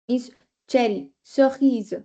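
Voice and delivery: normal talking voice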